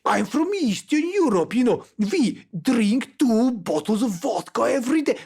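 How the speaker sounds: high-pitched Eastern European parody voice; exaggerated Eastern European accent. Shown as a demonstration. Parodying himself as a vodka-drinking local, exaggerated Eastern European accent. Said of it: "become this, like, parody".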